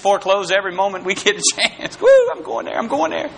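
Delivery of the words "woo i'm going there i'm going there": very high whoop that drops back down; the sinful urge voiced, high and gleeful; eager whoop. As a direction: play the giddy urge rushing off.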